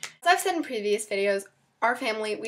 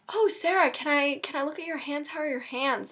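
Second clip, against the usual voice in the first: high-pitched mock nosy relative; higher, put-on busybody relative; prying relatives; 5 semitones higher than usual. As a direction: imitate a fussing relative, pitched up from your own voice. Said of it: "relatives will be like".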